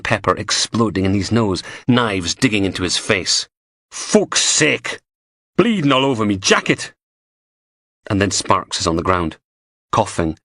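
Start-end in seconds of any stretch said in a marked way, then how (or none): 3.9-6.9 annoyed posh woman. A narrator's reading. Blondie annoyed at the blood.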